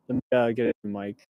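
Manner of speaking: ordinary talk, nothing marked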